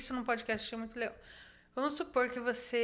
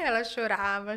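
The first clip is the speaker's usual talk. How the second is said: ordinary talk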